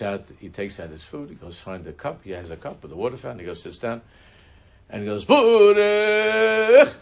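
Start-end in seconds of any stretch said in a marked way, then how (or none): 5.2-6.9 very high, loud, drawn-out holler; imitating a man bellowing his blessing. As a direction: holler out the blessing word at full volume, stretching it long. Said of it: "hollers out".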